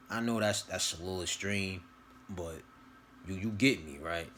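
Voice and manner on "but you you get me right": ordinary talk, nothing marked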